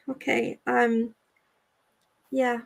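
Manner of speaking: ordinary delivery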